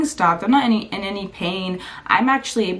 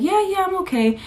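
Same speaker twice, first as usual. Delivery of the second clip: pitched up, brushing it off; high insistent reassurance; 10 semitones higher, faster than usual. Retold or imitated. To worried classmates, brushing them off high-pitched. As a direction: pretend everything's fine, up high.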